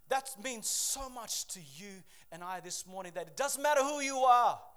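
loud, high, driving sermon voice; full-force preaching. Acted. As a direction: preach it with everything you have.

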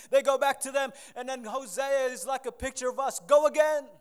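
loud, high, full-force preaching; fiery preaching. Acted. Preacher thundering to the church about going back. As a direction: preach it out with fire.

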